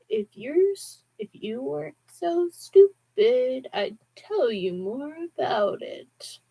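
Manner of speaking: a contemptuous teacher's drawl from a machine; slow scornful rasp with a southern hint; raspy bass voice. A narrator's reading. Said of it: "A bass voice with a blur-like rasp".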